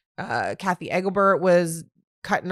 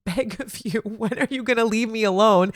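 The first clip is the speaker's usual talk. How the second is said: pleading exasperated; 3 semitones higher, slower than usual. Retold or imitated. Re-enacts exasperated plea.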